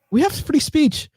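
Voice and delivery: put-on high-pitched, rough stab at German accent; attempted German accent. Host trying the German girl's accent, high mock voice. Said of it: "I don't know how to do a fucking her accent".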